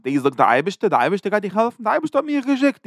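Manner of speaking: lightly acted taunt, pitch rising steeply; retold taunting claim. Teacher voices enemy envoy to Chizkiah's people, retold taunt.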